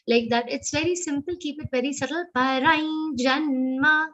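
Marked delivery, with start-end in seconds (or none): 2.4-4.1 "janma" with the push on "na"; stress on na. Shown as a demonstration. Teacher modelling verse stress, weight on na. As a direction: lean on the "na" of "janma". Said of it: "Ja has a stress of na".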